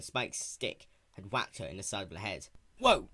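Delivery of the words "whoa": sudden high yelp of surprise; startled whoa. Acted. Spike startled after hitting Applejack, sudden whoa. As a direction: jump at the sound.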